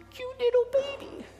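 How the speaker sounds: put-on high sing-song baby talk; cooing baby talk. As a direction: coo it out as if pinching a baby's cheeks. God coos over becoming baby.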